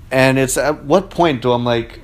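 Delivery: usual speaking voice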